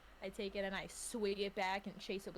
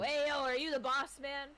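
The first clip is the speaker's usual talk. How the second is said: loud bro greeting; 6 semitones higher, 5 dB louder than usual. Acted. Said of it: "I go".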